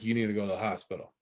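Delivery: lightly imitated big-brother firmness; quick, understated big-brother order. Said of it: "like, big brother voice".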